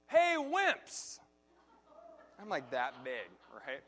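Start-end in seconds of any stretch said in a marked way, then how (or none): 0.1-1.2 put-on high, loud kid's taunt across a field; yelled taunt. Little boy yelling a taunt at two big boys across the field. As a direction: shout it out like a cocky little kid. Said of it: "I yelled out".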